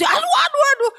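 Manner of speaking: very high, shouted wail; alarmed shout. Retold or imitated. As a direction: shriek in panic. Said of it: "Ini mesti".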